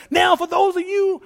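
high, loud revival preaching; preacher shouting. Retold or imitated. Pastor announcing healing to the crowd, shouted. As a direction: proclaim it at full force.

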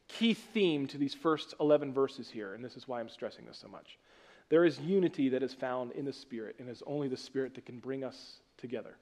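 ordinary talk, nothing marked

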